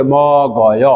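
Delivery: "ম গয়" with ম held, then গয়; demonstrated linking. Shown as a demonstration. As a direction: stretch ম, pause, then drop onto গয়. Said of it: "এইভাবে উচ্চারণ হবে".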